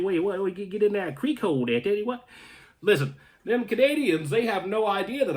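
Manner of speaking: a playful Southern quip; Southern US accent. Pretend. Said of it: "that accent right there".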